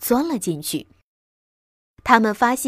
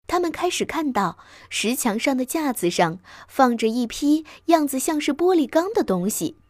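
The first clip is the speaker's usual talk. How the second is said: plain reading voice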